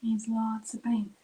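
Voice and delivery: whispered to herself while painting; soft aside, barely voiced. Real, not acted. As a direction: breathe it under your breath. Painting quietly, muttering to herself.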